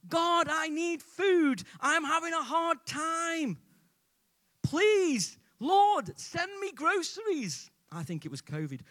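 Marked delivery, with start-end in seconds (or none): 0.1-7.7 very high, drawn-out pleading cry; shouted prayer. Acted. Elderly lady begging God for food, high shouted prayer. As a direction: cry out to heaven, stretching each phrase. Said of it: "She stood on a porch and shouted".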